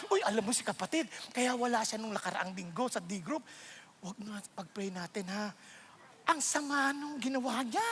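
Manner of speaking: gossiping. Pretend. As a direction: lean in like sharing juicy news. Mock church gossip about a brother. (pabulong na tsismis)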